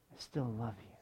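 soft, low, lingering reassurance; tender assurance. Acted. Preacher closes with God's tender promise to the congregation, softly.

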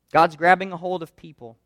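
ordinary talk

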